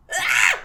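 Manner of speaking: short high loud shriek; sudden scream. Real, not acted. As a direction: let out a sharp "Ah!". Startled cry at the game.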